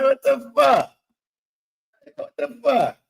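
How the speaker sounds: high, loud stunned outburst; shocked disbelief. Retold or imitated. Reacting to a missed swipe, high loud disbelief. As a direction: blurt it out in disbelief. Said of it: "I'm like".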